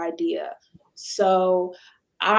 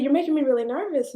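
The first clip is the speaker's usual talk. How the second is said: fast, higher, mildly flustered; nervous complaint; 6 semitones higher, faster than usual. Retold or imitated. Painter telling the owner she's unsettled, fast and higher. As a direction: blurt it out quickly. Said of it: "she said".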